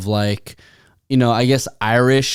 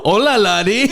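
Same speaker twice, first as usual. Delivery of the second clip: mock Irish accent; 7 semitones higher than usual. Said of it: "I was literally about to say".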